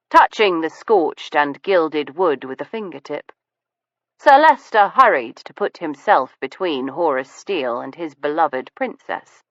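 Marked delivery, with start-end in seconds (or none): none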